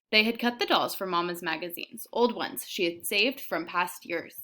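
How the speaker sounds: reading a novel aloud to students; steady read-aloud storytelling; story narration. A narrator's reading. Teacher reads novel aloud.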